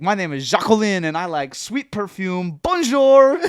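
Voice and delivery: hammed-up French accent, flirty. Puppet alter ego introducing himself, hammed-up French accent. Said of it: "is, like, a French guy".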